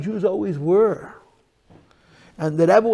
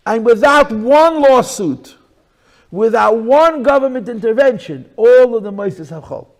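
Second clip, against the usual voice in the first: preaching at full force; 5 semitones higher, 8 dB louder than usual. Real, not acted. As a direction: hammer it at the congregation.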